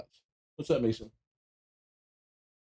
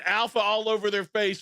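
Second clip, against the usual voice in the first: retold scream; 7 semitones higher than usual. Fan re-enacts calling Josh Allen's touchdown, mock scream. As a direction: imitate yourself screaming at the play. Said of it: "I'm screaming".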